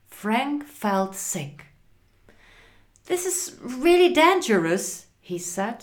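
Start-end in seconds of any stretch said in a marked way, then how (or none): 3.0-5.1 high, fast, worried voice; alarmed. Acted. Frank warns his friends in the speeding car, alarmed. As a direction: warn them with rising alarm.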